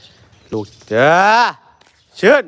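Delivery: lightly voiced keeper calling, rising high; a hint of the keeper's call, ending high. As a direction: call the elephant the way the keeper does. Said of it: "เออ เจ๊บอก".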